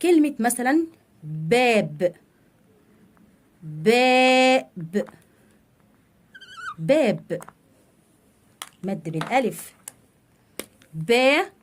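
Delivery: "باب" with its long a held very long; teacher drilling the long vowel in "باب"; syllable drill. Shown as a demonstration. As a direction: stretch the "ا" in "باب", then say "با". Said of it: "بالحركة الطويلة".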